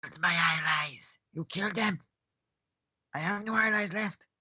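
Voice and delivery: dying monster sneers at its captor; creature voice. Acted. Defeated monster answers the rogue holding a sword to its neck. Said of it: "It kinda looks and goes".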